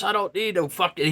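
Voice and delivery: ordinary talk, nothing marked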